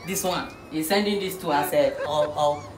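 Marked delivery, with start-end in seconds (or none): none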